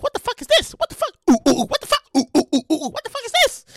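high shocked outcry with oohs; shocked reaction. Real, not acted.

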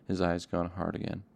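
usual speaking voice

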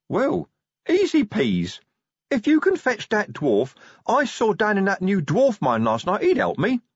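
high troll voice, pleased with himself; cheerfully oblivious troll; confident troll. Acted. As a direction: play a troll with an easy answer. Troll figure in the story.

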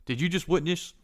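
stern acted demand; questioning guard. Guards interrogate the player as witness.

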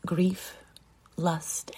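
usual speaking voice

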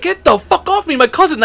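high-pitched mock outrage; retold angry protest. Teller fights off the tackling friend, mock furious protest. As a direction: act out an exasperated yell, pitch way up.

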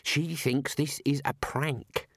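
ordinary delivery, nothing marked

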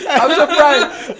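laughing. Real, not acted. Friends laughing at a roast.

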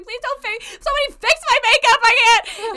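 very high, loud, fast wail; panicked crying. Retold or imitated. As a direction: plead in a tearful panic. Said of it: "She's like".

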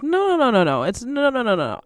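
rapid-fire high string of denials, falling away; insistent denial. Acted. Correcting people who call her crazy, insistent denial. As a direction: protest in a high, sliding voice.

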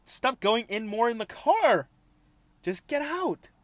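high, loud, drawn-out plea; exasperated panicked yell. Real, not acted. Yelling at a bug going further into the car, panicked. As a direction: shout it high, desperate to get it out.

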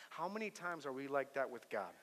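usual speaking voice

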